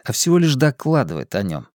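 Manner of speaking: plain reading voice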